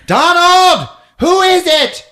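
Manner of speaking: high, loud call from the next room; wife shouting to her husband at the door, high and loud. Acted. Wife calling from another room.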